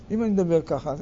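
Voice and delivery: ordinary talk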